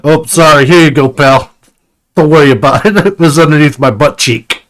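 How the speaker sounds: laughing apology, loud; chuckling through the words. Acted.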